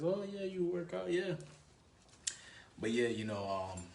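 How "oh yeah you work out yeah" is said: high-pitched imitation of the girl; put-on higher woman's voice; girl's reply. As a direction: play her reacting, voice lifted well up. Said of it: "She says".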